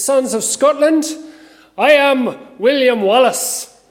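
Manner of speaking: put-on loud rallying cry in a Scottish accent; William Wallace. Minister opening as a warrior addressing his countrymen, loud Scottish accent. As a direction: proclaim it high and slow, attempting Scottish. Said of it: "my attempt at a Scottish accent".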